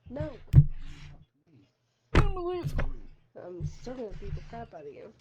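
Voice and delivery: loud, slow playful threat; playful shout. Real, not acted. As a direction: yell it out as a teasing threat. Playful fight, much louder.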